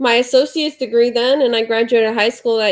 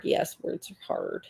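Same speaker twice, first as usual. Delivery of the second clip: breathy whispered echo, slower; whispered aside; 13 dB quieter, slower than usual. Pretend. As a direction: whisper it to yourself, dragging. Mock-solemn echo of own words.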